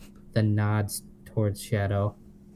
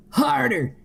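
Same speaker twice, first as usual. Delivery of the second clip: high yelled plea; hammed-up high-pitched yell; 5 dB louder than usual. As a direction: shout it up high and loud. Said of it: "he yelled".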